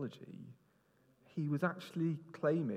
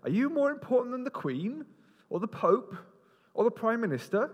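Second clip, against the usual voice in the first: mocking; 8 semitones higher, 4 dB louder than usual.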